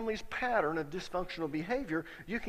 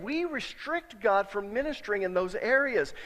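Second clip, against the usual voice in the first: usual speaking voice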